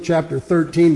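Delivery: usual speaking voice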